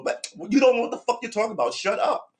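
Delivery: defiant comeback. Retold or imitated. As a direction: fire back at the doubters at full volume. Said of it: "if they keep going and go".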